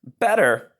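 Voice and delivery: put-on high little toddler echo; baby echo. Baby Honey Bear echoing Papa, babyish. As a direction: repeat it like a baby learning words.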